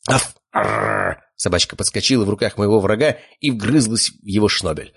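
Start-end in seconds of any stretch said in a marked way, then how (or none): 0.1-1.2 short bark, then a rolled growl; imitated lapdog yap and growl; dog bark and growl. A narrator's reading. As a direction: bark and snarl like a small dog.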